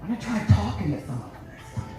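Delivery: wife on the road to Lancaster, soft giddy gush. Retold or imitated. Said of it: "She's like".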